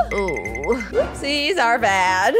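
ordinary talk, nothing marked

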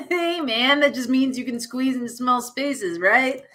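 high, laughing goofy guy; high chortle into the line; chortling dude voice. Acted. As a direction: chuckle it out like a party bro. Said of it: "With this chortle".

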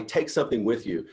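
usual speaking voice

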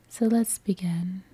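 unhurried, soothing guide, drawing out each release; guided meditation. Acted. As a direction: gently lead listeners through breathing and relaxing.

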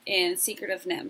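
usual speaking voice

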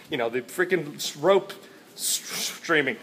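ordinary talk, nothing marked

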